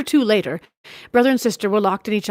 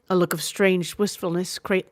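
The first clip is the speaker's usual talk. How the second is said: usual speaking voice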